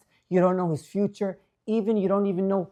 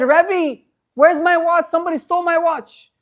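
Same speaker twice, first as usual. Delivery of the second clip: put-on upset child; 10 semitones higher, 7 dB louder than usual. Crying boy complains to teacher.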